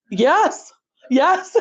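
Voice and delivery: high, thrilled mock yes; imitating delighted yes. Retelling the teacher's answer to the truck offer, high and delighted. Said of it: "smiling so big".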